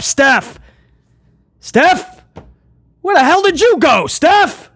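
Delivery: mock panicked calling. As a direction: call out in hammed-up distress. Acted husband calling for his wife.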